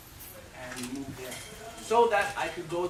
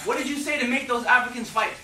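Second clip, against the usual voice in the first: accusing mom; 7 semitones higher, 8 dB louder than usual. Retold or imitated. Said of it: "my mom said to me".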